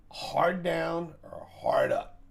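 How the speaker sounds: slow, high, drawn-out punchline; savoring a pun he waited all morning for; joke delivery. Real, not acted. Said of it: "I was waiting all morning to say that one".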